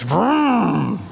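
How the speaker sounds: slow stalling vroom that rises and dies; drawn-out motor noise that fails to catch; sputtering engine vroom. Retold or imitated. The unit coughing to life under his hands, slow vroom.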